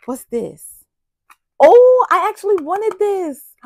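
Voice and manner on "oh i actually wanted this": much higher and louder delight; thrilled exclaim. Real, not acted. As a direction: burst out with happy surprise. Finds a wished-for gift.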